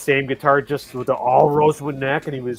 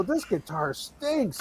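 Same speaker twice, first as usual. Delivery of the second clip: disgusted; 4 semitones higher, 7 dB quieter, much slower than usual. Retold or imitated. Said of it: "I go".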